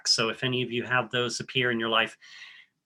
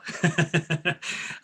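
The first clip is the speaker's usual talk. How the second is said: laugh. Real, not acted. Laughs at herself.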